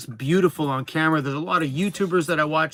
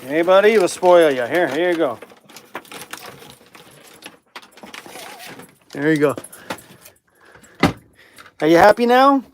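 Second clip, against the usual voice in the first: excited praise; 6 dB louder than usual. Real, not acted. Owner cheerfully handing his dog a treat. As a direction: reward the dog with a happy cheer.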